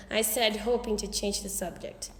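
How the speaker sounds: plain reading voice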